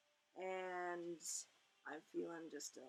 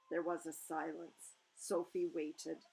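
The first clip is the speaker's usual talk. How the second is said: ordinary delivery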